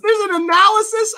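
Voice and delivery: loud, high-pitched disbelief; incredulous outburst. Real, not acted. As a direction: shriek the question in amused astonishment. Stunned at finding poem analysis.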